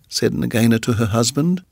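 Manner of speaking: plain reading voice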